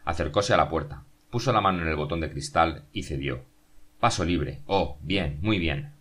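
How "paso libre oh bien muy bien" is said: ordinary delivery, nothing marked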